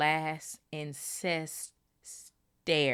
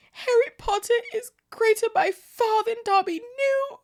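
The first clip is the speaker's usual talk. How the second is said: high, louder elf voice, mock sobbing; 8 dB louder than usual. Dobby hugging Harry and sobbing his thanks. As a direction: gush it through tears. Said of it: "he sobbed".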